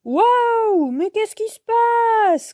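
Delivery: very high-pitched yelp of surprise; startled cry. A narrator's reading. Yo-Yo loses control of scooter, startled cry. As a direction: cry out as you lose control. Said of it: "crie-t-il".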